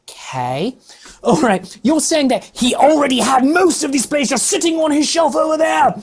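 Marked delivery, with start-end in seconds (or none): 1.8-5.9 higher, incredulous rant; exasperated rant. Real, not acted. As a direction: rail at the claim, voice climbing. Ranting at the film's claim.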